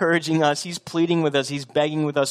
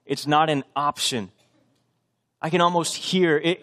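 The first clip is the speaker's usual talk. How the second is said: normal talking voice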